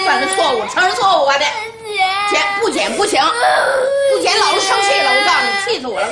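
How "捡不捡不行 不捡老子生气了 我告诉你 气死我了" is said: angry shout with a choked, tearful edge; crying out in fury at the stubborn child; furious shout. Acted. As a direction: yell at the child until the voice cracks.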